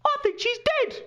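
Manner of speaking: put-on high-pitched kid's blurt; sudden shrill-pitched outburst, mimicking a boy. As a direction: blurt it in a squeaky child's voice. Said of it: "blurts out".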